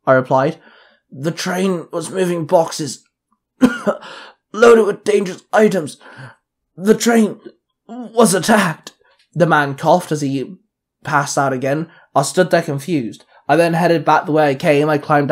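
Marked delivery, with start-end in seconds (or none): 1.1-8.9 weak dying man. A narrator's reading. Dying conductor, coughing.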